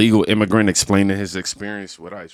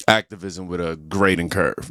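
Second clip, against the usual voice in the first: normal talking voice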